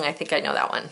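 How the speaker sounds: usual speaking voice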